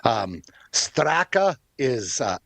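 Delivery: usual speaking voice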